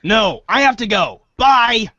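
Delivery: ordinary talk, nothing marked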